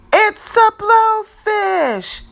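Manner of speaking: high, loud, slow announcement; excited reveal. A narrator's reading. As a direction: make the reveal big and slow. Reveal of blowfish.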